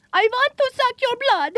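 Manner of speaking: hammy vampire, lightly voiced; a hint of Dracula. Zombie plays a vampire for date.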